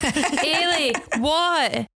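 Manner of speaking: high, mock impatient call; play-acted shout for someone upstairs. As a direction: pitch it up as if calling through the house. Acting wee figure calling upstairs.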